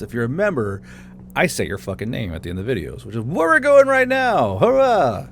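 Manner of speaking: plain reading voice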